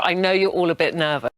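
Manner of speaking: normal talking voice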